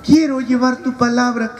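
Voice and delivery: mock-earnest young believer praying; earnest prayer. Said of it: "Tiene que pedirle al Espíritu Santo".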